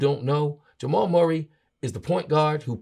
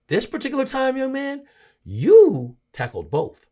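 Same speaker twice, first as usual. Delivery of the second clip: scolding; 10 semitones higher, 5 dB louder than usual. Real, not acted. Host scolding player, rising anger.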